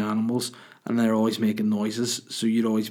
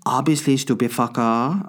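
put-on louder, scornful accented dog; mock Chinese accent; 2 semitones higher, 4 dB louder than usual. Dog insulting owner over a snack, mock Chinese accent. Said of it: "But Charlie would be like".

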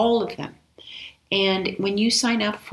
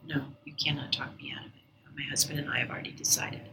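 hushed tearful refusal; 8 dB quieter, faster than usual. Retold or imitated. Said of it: "but I was crying".

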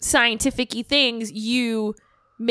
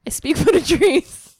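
higher, louder Lorax impression; 5 semitones higher, 4 dB louder than usual. Sharing her one Lorax line, higher louder Lorax voice. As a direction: proclaim it like the Lorax. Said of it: "that's the only line I got".

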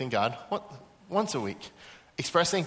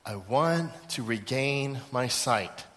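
slow, lower, deliberate request; earnest plea; 4 semitones lower, 4 dB quieter, slower than usual. Acted. Blind Bartimaeus answers what he wants from Jesus, earnest plea. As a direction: spell out the wish word by word. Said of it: "Expression of faith number three".

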